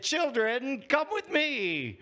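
high, slow beckoning call. Acted. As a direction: call the children to come. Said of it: "I said".

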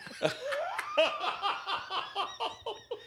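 shrieking laughter. Real, not acted. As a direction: burst out laughing. Big laugh.

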